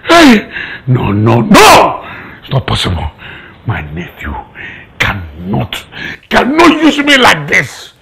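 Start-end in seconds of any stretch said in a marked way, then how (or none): 2.4-5.8 very low, soft, halting words; stunned disbelief. Acted. Uncle realising his nephew used him, stunned disbelief. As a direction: sink into low, broken phrases.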